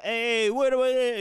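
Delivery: sudden high, loud challenge; mock street challenge; confrontational tough guy. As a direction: call someone out mid-stride. Acting out confrontational flashy people.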